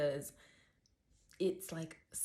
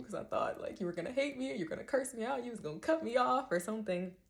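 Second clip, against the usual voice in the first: high, tearful rush of relief; tearing up; 7 semitones higher, 3 dB louder than usual. Real, not acted. Thanking a friend through tears, high and tearful. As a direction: let the voice go up and wobble as tears come. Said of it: "I'm literally, like, tearing up".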